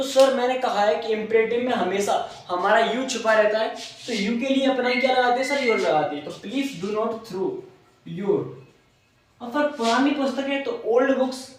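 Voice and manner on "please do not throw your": very slow, word by word; teacher dictating to the class; slow dictation. Shown as a demonstration. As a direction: dictate so the class can write it down. Teacher dictates sentence to students.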